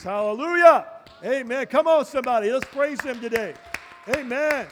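loud call to stand and praise; rally the congregation to their feet; preacher lifting the room into worship; rousing praise call. Acted. Pastor calls church to praise.

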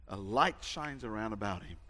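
ordinary talk

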